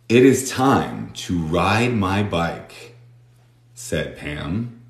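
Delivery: It is time to ride my bike, very slow and even; slow read-aloud. Shown as a demonstration. Teacher reads the story line slowly for learners. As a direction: read each word slowly and clearly. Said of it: "reading practice sentence".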